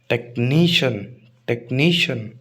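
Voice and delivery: Technician as tek-NEE-shun, नी held; stress before shun. Shown as a demonstration. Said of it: "शन से पहले वाला". (Technician के नी पर ज़ोर)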